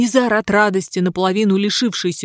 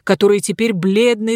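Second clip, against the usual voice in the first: plain reading voice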